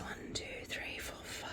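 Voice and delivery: barely voiced whisper to yourself; whispered counting. Real, not acted. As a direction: count under your breath. Counts to self under breath.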